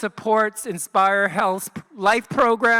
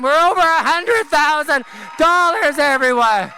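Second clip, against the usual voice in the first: auction caller; 5 semitones higher than usual. Acted. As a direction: announce each bidder like a gala auctioneer. Calling paddles, rallying crowd.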